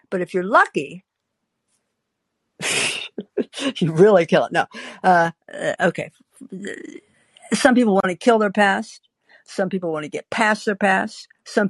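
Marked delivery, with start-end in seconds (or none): none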